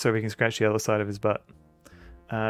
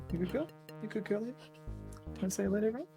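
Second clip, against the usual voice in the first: pet talk voice; 10 semitones higher, 9 dB quieter, much faster than usual. Real, not acted. Talking sweetly to her dog.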